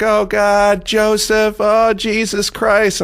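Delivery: high, drawn-out mock wail; retold tearful cry, pitched high and held; imitating crying mom. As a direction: wail it like a distraught mother. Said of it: "My mom's crying".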